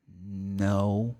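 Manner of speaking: low, subdued "no" from an unsure learner; hesitant student. Retold or imitated. Student caught out by the teacher, hesitant. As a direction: answer reluctantly, low and soft.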